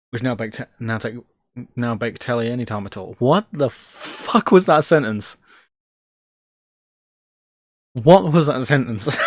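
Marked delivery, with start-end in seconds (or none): 3.2-9.2 baffled. Real, not acted. Baffled at own misspoken sentence.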